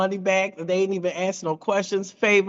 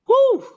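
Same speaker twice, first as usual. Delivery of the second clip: relief; 6 dB louder than usual. Retold or imitated. Relief after reaching the goal.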